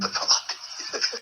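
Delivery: breathy unvoiced chuckle; a quick soft laugh of disbelief; short laugh. Speaker mimics the soldier's laughter, a breathy chuckle. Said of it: "And laughing".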